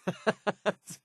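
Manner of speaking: short high giggle; self-amused chuckle. Acted. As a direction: laugh at your own outburst. Laughs at own shouted slogan.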